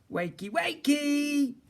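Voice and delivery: high, slow, drawn-out wakey wakey; sing-song wake-up call. A narrator's reading. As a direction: coax the dragon awake playfully. Waking up the pet dragon.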